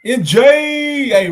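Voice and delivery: loud call across the room. Real, not acted. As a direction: shout a name to get attention. Calling out to child.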